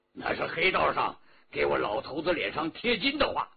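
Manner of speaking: usual speaking voice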